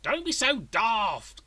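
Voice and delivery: high, slow, loud scoffing mother; blunt dismissal. A narrator's reading. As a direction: brush him off bluntly. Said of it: "came a blunt response".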